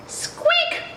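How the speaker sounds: high squeak then alarmed cry; Mister Mouse squeaks in dismay at the broken tusk; squeaky mouse yell. Acted. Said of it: "Mister Mouse yelled".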